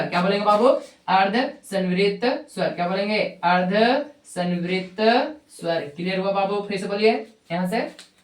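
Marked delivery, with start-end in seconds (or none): 1.1-2.7 slow, word-by-word teacher delivery; measured, drawn-out term drill; slow dictation. Shown as a demonstration. Teacher spells out term for repetition. 3.4-5.8 very slow, drawn-out word endings; stretched-out classroom drill; slow dictation. Shown as a demonstration. Teacher drills term word by word.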